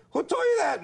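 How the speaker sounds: very high mock defensive cry; high-pitched evasive retort; busted comeback. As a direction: blurt it like someone caught out, voice way up. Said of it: "we always go".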